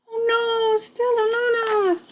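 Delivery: high gasping cry of alarm. Real, not acted. Reader gasping as the baby bat falls, high and alarmed. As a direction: gasp and cry out her name in real alarm.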